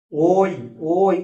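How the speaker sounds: ওই sounded out loud as a gliding o-i; demonstrated diphthong. Shown as a demonstration. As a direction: say ওই emphatically, o running into i. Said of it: "উচ্চারণটা নিজেরা করে দেখো".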